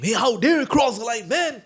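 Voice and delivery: angry policeman. Retold or imitated. Policeman scolds the monk for crossing the line, mock angry. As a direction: bark at him like a cop who caught a rider over the line. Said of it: "So police wala came".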